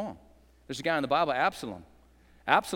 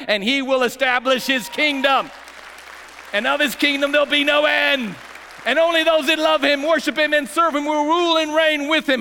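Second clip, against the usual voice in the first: loud, high, ringing proclamation; rally the whole room; preaching at full force; 11 semitones higher, 11 dB louder than usual. Real, not acted. Pastor calling out to every group, fiery preaching.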